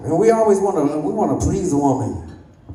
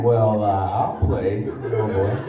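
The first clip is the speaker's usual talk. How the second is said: creepy priest; 6 semitones lower, slower than usual. Retold or imitated. A priest leaning in toward a boy, low and sly.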